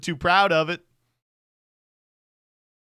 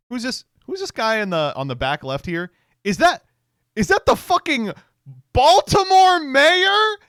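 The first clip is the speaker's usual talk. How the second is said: climbing higher and louder in disbelief; incredulous rising shout; 11 semitones higher, 11 dB louder than usual. Retold or imitated. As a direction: stretch out Baltimore mayor in shock. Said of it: "and then I went".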